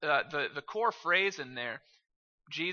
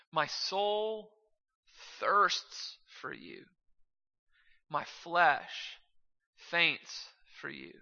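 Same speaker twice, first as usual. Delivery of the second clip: slow, measured scripture reading with long pauses; slow psalm reading; slower than usual. Real, not acted. Preacher reading a psalm to the congregation, slowly and deliberately. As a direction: let each phrase stand alone, unhurried. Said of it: "let's just read Psalm sixty-three slowly".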